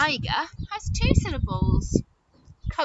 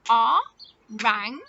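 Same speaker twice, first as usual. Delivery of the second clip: "Orangutan" in four separated beats, louder; syllable by syllable; 3 semitones lower, 5 dB louder than usual. Shown as a demonstration. Teacher claps again with children, "Orangutan" in four beats. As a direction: break up O, rang, u, tan. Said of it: "Let's try again".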